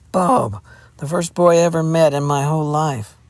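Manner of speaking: wounded pride, overplayed; tragic resentment. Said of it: "one of tragic resentment".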